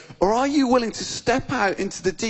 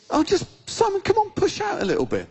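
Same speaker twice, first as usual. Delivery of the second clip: high, offhand retold request; casual; 12 semitones higher than usual. Jesus in the boat asks Simon for a push, casual. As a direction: ask casually in a much higher voice. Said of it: "he's casually in a boat".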